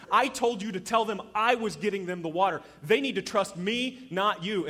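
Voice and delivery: loud, high, emphatic scolding; stern acted rebuke. God telling Moses whom they must trust, sternly rebuking. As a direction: hammer the contrast between me and you.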